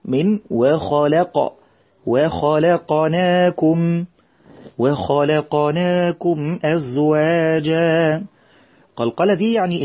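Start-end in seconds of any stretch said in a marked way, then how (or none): 2.0-8.2 وخلقناكم with the qaf bounced out clearly, slow; clear qalqala on qaf. Shown as a demonstration. Teacher shows the children the qalqala, slow recitation. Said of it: "نبين القلقلة".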